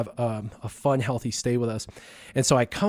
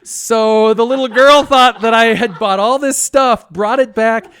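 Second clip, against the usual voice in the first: mortified; 9 semitones higher, 9 dB louder than usual. Real, not acted.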